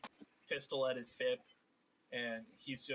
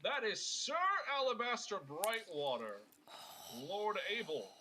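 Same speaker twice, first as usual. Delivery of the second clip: higher, haughty adventurer; pompous correction; 7 semitones higher, 3 dB louder than usual. Acted. Alabaster correcting Abel on his title, pompous. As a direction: insist grandly on the title.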